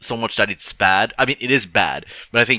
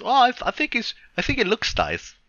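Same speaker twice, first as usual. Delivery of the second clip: much higher, timid wife voice; wife's polite voice; 13 semitones higher than usual. Retold or imitated. The wife meekly defends the man, high voice. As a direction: chirp it meekly, like a hesitant wife. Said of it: "And the wife says".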